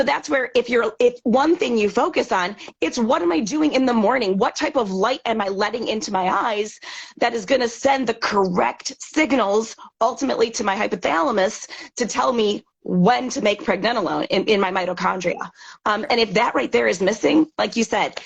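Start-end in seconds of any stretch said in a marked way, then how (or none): none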